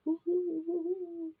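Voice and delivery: high hooting owl calls; owl hoots. Shown as a demonstration. Vlogger showing off an owl voice, high hooting. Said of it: "That's my best owl voice".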